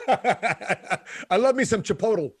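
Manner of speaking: slow, laughing through the words. Real, not acted. Host confessing love for Chipotle, laughing slowly. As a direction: savor it while laughing. Laughing about favorite lunch.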